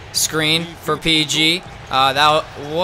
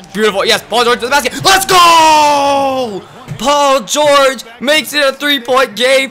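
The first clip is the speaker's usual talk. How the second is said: shouted, higher call; hyped play-by-play; 9 semitones higher, 6 dB louder, slower than usual. Real, not acted. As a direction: call the drive like a TV announcer. Yelling live play-by-play.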